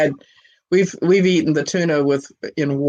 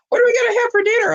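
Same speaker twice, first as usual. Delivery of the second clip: put-on much higher, quick little-girl voice; child's question; much faster than usual. Little daughter asking mom in the store, high and quick. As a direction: pipe it up fast like a small kid.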